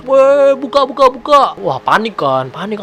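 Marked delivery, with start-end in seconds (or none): 0.1-1.4 high, loud repeated yell; urgent shouted demand. Retold or imitated. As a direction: shout through a locked door to be let in. Suami menggedor pintu yang terkunci. (teriak mendesak minta dibukakan pintu)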